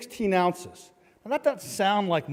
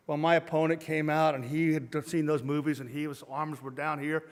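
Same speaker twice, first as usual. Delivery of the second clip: usual speaking voice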